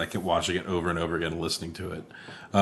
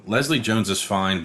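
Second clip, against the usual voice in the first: normal talking voice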